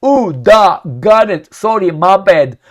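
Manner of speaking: loud, hammed-up apology; booming comic radio reply; mock Russian pilot. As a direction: play a Russian hurriedly backing off. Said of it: "And the Russian says".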